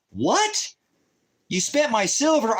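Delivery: "What?" jumps way up, then a high indignant accusation; shocked cry at hearing the silver is gone; outraged disbelief. Retold or imitated. Retells her outraged reply.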